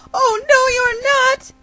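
very high squealed refusal; threatened cat's high outcry; alarmed protest. Acted. Cat protests being eaten.

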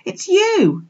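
high, loud exclamation; breathless cry. A narrator's reading. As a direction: cry out on recognising Mum. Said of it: "he cried breathlessly".

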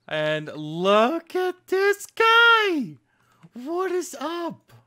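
very high, loud, slow delighted squeal. Real, not acted. Player squealing at a cute turtle mob, delighted. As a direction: coo at the cute little creature.

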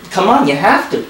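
usual speaking voice

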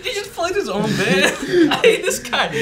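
laughing through the words; teasing a friend while cracking up. Real, not acted. Laughing at bandmate's joke.

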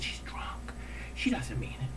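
hushed, urgent excuse; whispered plea. Retold or imitated. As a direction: whisper it as an aside. Boyfriend pleading at ticket window.

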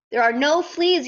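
yelled. A narrator's reading. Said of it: "yelled Dodsworth".

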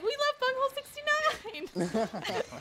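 high, loud cheer breaking into laughter; imitated gleeful cheer. Quoting charity representative's typed message, gleeful mock cheer. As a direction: cheer it high, then crack up.

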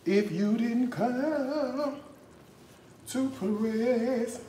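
mock church sermon drawn out slow; mock preacher. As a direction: preach it at him like a gospel pastor, then sing the end. Mock preacher sermon, sung ending.

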